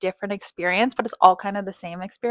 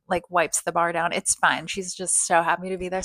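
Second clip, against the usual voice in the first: ordinary delivery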